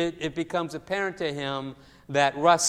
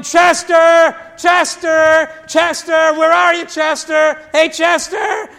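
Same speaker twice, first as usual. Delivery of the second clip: put-on loud, high calls of a kid shouting for his dog; kid yelling; 8 semitones higher, 13 dB louder, slower than usual. Kid runs through the house shouting for his dog Chester, loud and high. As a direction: yell the name across the house, pitched up. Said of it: "and he's yelling".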